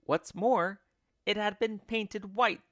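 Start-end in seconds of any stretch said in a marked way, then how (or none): none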